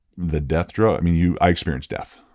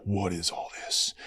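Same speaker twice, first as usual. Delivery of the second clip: soft, slow, awed "What is all this?"; hushed wonder; 3 semitones higher, 10 dB quieter than usual. Retold or imitated. Sitting in the grass after the trip, hushed wonder. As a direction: breathe it out in amazement.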